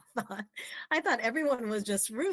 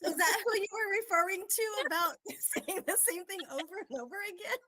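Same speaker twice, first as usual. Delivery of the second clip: laughing tease; 10 semitones higher than usual. Real, not acted. Teasing question, laughing heard.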